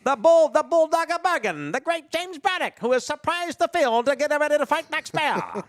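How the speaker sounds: put-on high, loud old-time ringside announcer; excited vintage boxing announcer, ringside at the Garden; 1920s broadcaster. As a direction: call the fight like a 1920s radio man. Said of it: "the nineteen twenties broadcaster guy".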